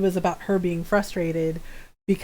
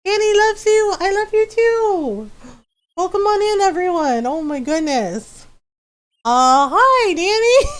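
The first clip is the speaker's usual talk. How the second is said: high, loud, delighted hellos; excited raid welcome; 8 semitones higher, 5 dB louder than usual. Real, not acted. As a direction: gush with joy at the raid. Streamer greeting a raid.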